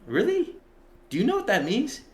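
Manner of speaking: high incredulous questions; rising, amazed questions; imitating friends' disbelief up high; mock disbelief. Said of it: "they're like".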